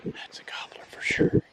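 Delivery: hushed whisper in the woods; hunting whisper. Real, not acted. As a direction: whisper so the birds can't hear.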